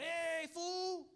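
high, loud taunt across the valley; yelling taunt. Retold or imitated. Soldiers yelling at the enemy across a valley, shouted. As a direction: shout the insult at the far hill. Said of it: "they were just yelling at each other".

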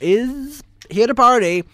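loud, slow, high hype line; introducing Marty, loud slow hype; party hype. Pretend. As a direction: belt it like a party announcer. Announcing housemate like a host.

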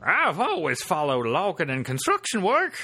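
put-on high old prospector drawl, slow. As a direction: play a grizzled old prospector. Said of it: "like a prospector voice?".

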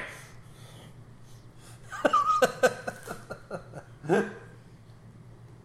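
high breathless laughing fit; real laughter at the joke; burst of laughter. Real, not acted. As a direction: crack up helplessly.

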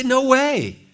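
high, loud incredulous no way; hammed-up disbelief; mock no way. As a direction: scoff in disbelief. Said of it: "We would say".

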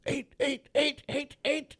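high, urgent repeated call; imitating an urgent call. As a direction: call it out fast and high like a warning. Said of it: "Mark's like".

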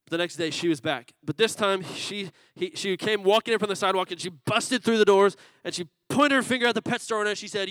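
usual speaking voice

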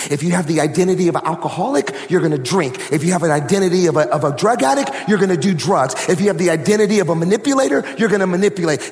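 building, rising, driving sermon cadence; preaching at full force. Acted. Urging the church to take on identity, building to shouted preaching.

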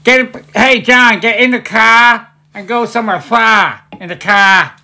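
loud, high mock Boston accent. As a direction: holler it slowly in a hammed-up Boston accent. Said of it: "it's a Boston accent".

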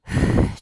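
long audible exhale; heavy outbreath mid-advice; sigh. Real, not acted. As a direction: let out a big breath. Pauses while thinking of advice.

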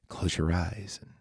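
soft unvoiced whisper, hushed like a bedtime story; mock guided-journey whisper. Host playfully guiding podcast listeners into the story, whispered. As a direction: breathe it to the listeners as if leading a trance. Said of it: "Take you guys on a little journey".

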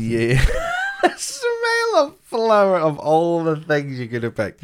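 high, drawn-out laughing disbelief; wheezy, tearful laugh on the words; laughing incredulity. Real, not acted. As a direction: squeal it out slowly through helpless laughter. Mocking the flowers remark through laughter.

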